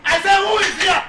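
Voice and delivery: very high, very loud demand; insistent, full-volume yell; shouted challenge. Acted. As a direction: shout it out at whoever is hiding. Repeats demand to unseen intruder.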